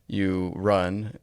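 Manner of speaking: ordinary talk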